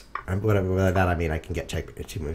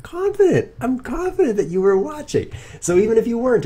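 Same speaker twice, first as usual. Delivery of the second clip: much higher and louder, puffed-up declaration; mock bold confidence; 7 dB louder than usual. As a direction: proclaim it like a triumphant hero. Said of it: "you know what I am? Confident".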